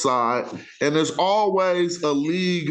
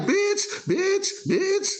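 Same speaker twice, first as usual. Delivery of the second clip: much higher, mocking girly voice; mimicking women chatting; much slower than usual. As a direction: parody the women calling each other names. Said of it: "they be like".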